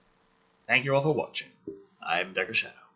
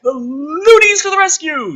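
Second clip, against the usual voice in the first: mock heroic; 7 dB louder than usual. Mocking script's heroic line.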